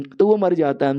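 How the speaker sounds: plain reading voice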